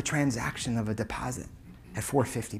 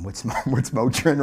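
lightly mocking himself as a clueless dad, with a laugh; amused, louder self-parody; clueless father; 5 dB louder than usual. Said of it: "I'm like this clueless father".

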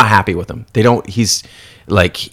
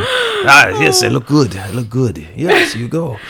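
put-on vain old vampire, pleased with himself; lightly voiced smug vampire; pompous vampire. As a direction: preen over a bad self-portrait. Vampire admiring bad self-portrait.

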